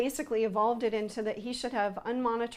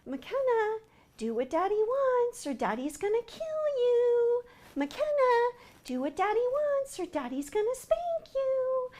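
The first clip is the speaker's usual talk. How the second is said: much higher, sing-song sweet voice, child imitating daddy; 13 semitones higher than usual. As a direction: coax the name sweetly, like a gentle threat. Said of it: "He says it so nicely".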